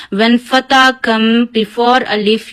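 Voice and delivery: usual speaking voice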